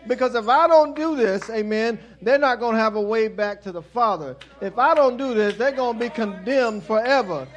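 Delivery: lightly voiced Christ, pushing through his pain out of love; Christ on the cross, preached.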